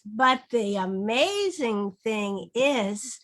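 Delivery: slow, rising on amazing; choral read. Shown as a demonstration. Teacher leading a choral read, slow and rising. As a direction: lead the class through the line. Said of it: "read that last line with it".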